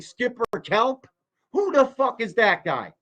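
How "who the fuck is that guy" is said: usual speaking voice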